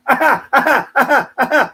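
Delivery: loud, high, repeated ha-ha burst; staccato exaggerated laugh at own joke; mock laugh. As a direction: laugh in even, punched bursts.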